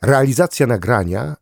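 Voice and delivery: ordinary delivery, nothing marked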